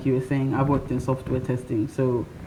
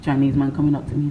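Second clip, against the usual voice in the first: ordinary talk, nothing marked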